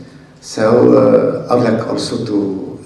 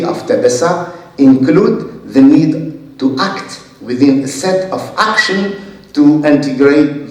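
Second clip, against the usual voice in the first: measured academic reading with lively rises on phrases; formal recitation with emphatic, lifted key words; formal read-aloud; 5 semitones higher than usual. Real, not acted. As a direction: read the thesis out deliberately, phrase by phrase. Reads thesis text aloud.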